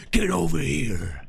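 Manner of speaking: slow, lightly voiced Mortal Kombat fighter catchphrase; Scorpion line. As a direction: drag it out like the game's ninja. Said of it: "that was the one".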